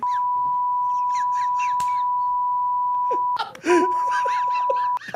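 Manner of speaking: wild high-pitched burst of laughter; howling high laugh mid-rant; shrieking high laugh. Real, not acted. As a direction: lose it completely. Huge laugh.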